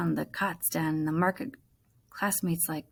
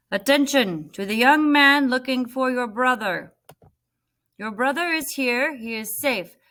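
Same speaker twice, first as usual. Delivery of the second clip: higher, louder public address call; loudspeaker announcement; 5 semitones higher, 5 dB louder than usual. Acted. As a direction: announce it over the camp loudspeaker.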